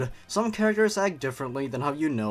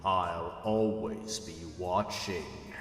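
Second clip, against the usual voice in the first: creepy ghostly voice; 6 semitones lower, 6 dB quieter, much slower than usual. Acted. Haunting entity speaks to narrator.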